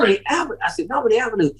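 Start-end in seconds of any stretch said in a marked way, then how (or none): 0.0-0.6 higher, rising disbelief at the street name; excited discovery. Retold or imitated. Spotting the long-sought street. 0.9-1.5 higher, falling cry of relief; joyful cry. Retold or imitated. Repeating it, cry of joy.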